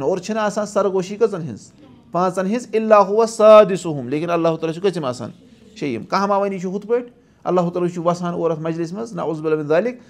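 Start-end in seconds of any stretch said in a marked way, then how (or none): 2.7-4.1 slow, melodic Quran recitation; Quranic recitation. Acted. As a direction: chant the verse slowly.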